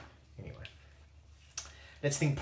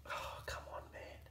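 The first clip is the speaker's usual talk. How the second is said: frustrated whisper, much softer; whispered frustration; 12 dB quieter than usual. Real, not acted. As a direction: hiss it under your breath at your own slip. Frustrated at a mistake.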